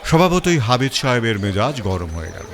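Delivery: ordinary delivery, nothing marked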